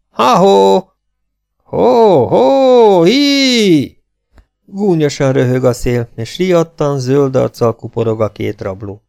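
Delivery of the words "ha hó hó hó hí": long, high, very loud hallooing; hoarse hailing shout. A narrator's reading. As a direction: halloo across the plain, stretching each cry. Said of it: "köhögő, reket hangon".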